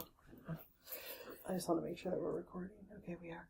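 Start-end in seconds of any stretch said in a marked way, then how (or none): 1.5-3.5 very soft, dropping to a whisper; near-whispered aside to yourself; hushed aside. Real, not acted. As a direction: check under your breath, off to the side. Aside checking that the recorder runs.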